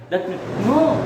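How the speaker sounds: ordinary talk, nothing marked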